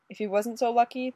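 plain reading voice

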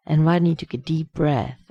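ordinary delivery

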